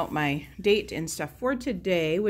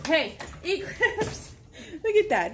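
laughing; 8 semitones higher, slower than usual. Real, not acted. Catches dog eating food.

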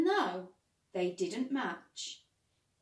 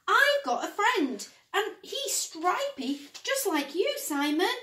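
high, loud, eager helper voice; eager Ted; 8 semitones higher, 6 dB louder than usual. Acted. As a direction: pitch it up with excitement. Ted voices his plan.

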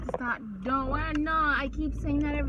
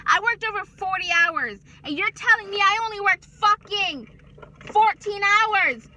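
furious rant; 6 semitones higher, 8 dB louder than usual. Real, not acted. Worker raging at employer over paycheck hours, loud outrage. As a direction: blast the payroll with disbelief.

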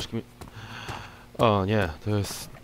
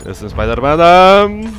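slow triumphant shout; 6 semitones higher, 13 dB louder, slower than usual. Pretend. Shouts his hero line.

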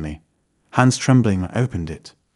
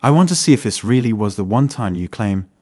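ordinary delivery, nothing marked

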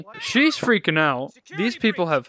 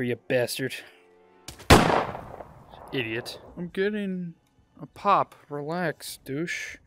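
muttering; 6 dB quieter, slower than usual. Real, not acted. Muttering insults at NPCs.

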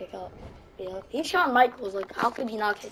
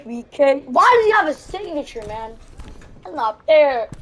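frustrated complaining; 4 semitones higher, 9 dB louder, slower than usual. Real, not acted. Complaining at the game.

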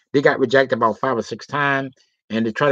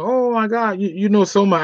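excited high woman's voice; 7 semitones higher than usual. Retold or imitated.

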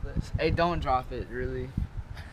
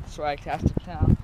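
usual speaking voice

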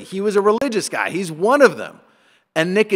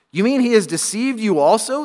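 higher-pitched, challenging question; retort; 5 semitones higher, 3 dB louder than usual. A narrator's reading. Pharisees answering the temple guards, retorting. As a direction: throw it back at them, incredulous. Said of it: "the Pharisees retorted".

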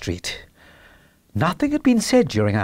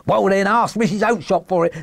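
higher, exasperated trader's voice; irritated salesman; 6 semitones higher than usual. Acted. Salesman rebuffing the little man, irritated. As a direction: brush him off crossly.

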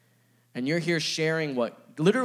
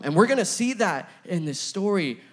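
ordinary talk

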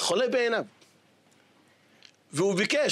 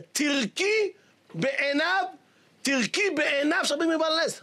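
harsh husband's command; 7 semitones higher than usual. Retold or imitated. Voicing husband's command to spit.

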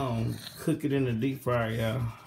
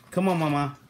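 ordinary delivery

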